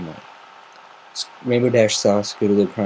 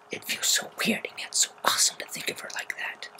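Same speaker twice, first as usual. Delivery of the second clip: hushed whisper, much softer; confiding a private wonder; 8 dB quieter than usual. Acted. Whisper heard.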